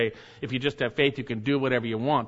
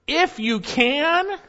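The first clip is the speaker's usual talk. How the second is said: a slow, much higher, stretched out "If you can"; Jesus turns "If you can" back on him, drawn out; reproving emphasis; 5 dB louder, much slower than usual. Acted. Jesus rebuking the doubting father, slow and high. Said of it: "He reproves the dead".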